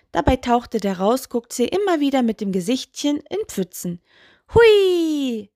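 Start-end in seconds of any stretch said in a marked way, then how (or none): 4.5-5.5 high, loud, drawn-out whoop gliding down; gleeful whee. A narrator's reading. Toe splashes through puddles, calling out gleefully. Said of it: "rief er und platschte".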